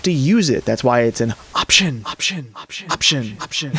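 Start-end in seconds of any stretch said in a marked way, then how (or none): 1.5-3.7 "option" echoing away; repeated word bouncing like an echo, softer; echoed emphasis, dropping in level; echo effect. Shown as a demonstration. Key word repeated as fading echo.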